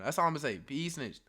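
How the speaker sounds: normal talking voice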